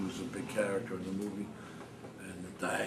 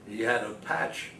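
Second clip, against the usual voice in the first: ordinary talk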